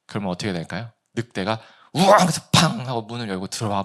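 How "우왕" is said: sudden loud mock roar; imitated wolf roar. Preacher as wolf bursting through door, mock roar.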